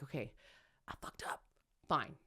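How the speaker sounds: soft, hushed inner voice; quiet self-talk. Retold or imitated. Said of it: "you get into the practice of".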